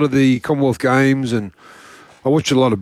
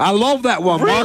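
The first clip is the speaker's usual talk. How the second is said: put-on Texas accent; 7 semitones higher than usual. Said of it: "the, the Texas".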